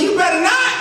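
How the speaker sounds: high-pitched, forceful retort; retold heated warning. Consultant retorting to a hesitant official, heated warning. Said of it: "I'm sorry, I'm yelling".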